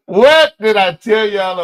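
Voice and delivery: loud, slow told-you-so; loud told-you-so to the viewers; gloating. Real, not acted. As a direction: rub it in, I called it. Gloating that his call was right.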